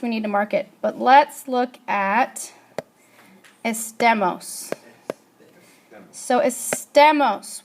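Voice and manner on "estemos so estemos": "estemos" slowed, stressed on the middle "te"; stress on second-to-last syllable. Shown as a demonstration. Teacher asking class to hear it, "estemos" slow with middle-syllable stress. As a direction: say "estemos" slowly as es-TE-mos. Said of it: "where do you hear the stress?".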